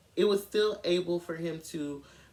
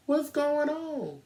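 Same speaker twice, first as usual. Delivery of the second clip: high, drawn-out feigned ignorance; mock innocent; 8 semitones higher, 4 dB louder, slower than usual. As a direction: play clueless, pitched up and stretched out. Casey feigns ignorance to the police.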